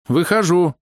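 high female announcement; high-pitched reluctant call; high-voiced woman. A narrator's reading. Hidden woman announces herself.

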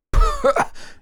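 high, short burst of laughter; snorted laugh. A narrator's reading. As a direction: let a laugh slip out, unable to hold it. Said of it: "Не выдержал и усмехнулся Заварзин". (прыснуть со смеху)

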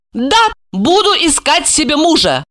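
high, assured confirmation; sure-of-herself woman; confident. A narrator's reading. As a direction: state it with certainty, voice lifted. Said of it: "уверенно подтвердила она".

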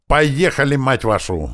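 high, loud rallying order; shouted hurry-up. A narrator's reading. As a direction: yell to get everyone moving. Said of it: "слово услышал я голос Грача". (громкий крик, поторапливающий всех)

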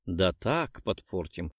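ordinary delivery, nothing marked

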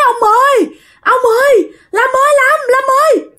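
high-pitched mock cry for help; retold panicked cries. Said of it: "bà la làng lên". (giả giọng bà la làng hốt hoảng)

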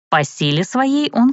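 plain reading voice

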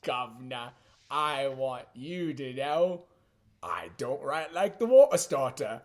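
slow, hammed-up Cockney; mock British accent. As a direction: drag it out like a fake Londoner. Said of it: "I see why we're talking like this now".

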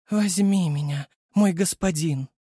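слегка томный женский голос; мягко промурлыкать, лишь намёком; томно мурлыча. Acted. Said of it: "томным голосом промурлыкала она". (девушка томно зовёт господина)

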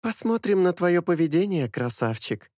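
put-on teasing girl's voice; smirking reply. A narrator's reading. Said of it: "усмехнулась в ответ она". (усмешливо, дразня)

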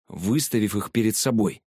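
ordinary delivery

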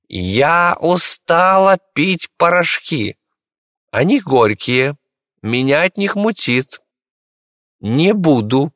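high, slow capricious lady; капризная госпожа. Acted. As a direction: whine it like a spoiled noblewoman. Капризная госпожа отказывается от лекарства. (капризный голос госпожи)

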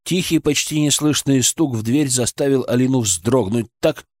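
usual speaking voice

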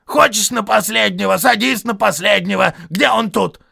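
loud, high quarrelsome troll; Том. A narrator's reading. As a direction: challenge him in a high yell. Troll Tom goes on.

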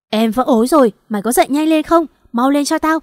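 loud, high, exasperated command; angry urging. Acted. Wife whose waters broke rousing her husband, angrily urgent. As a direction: order him up loudly, losing patience.

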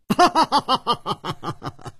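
delighted high cackle, long and pulsing; high staccato belly laugh. A narrator's reading. Old friend laughing on a chance reunion, high and delighted. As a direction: burst out laughing in a rapid peal.

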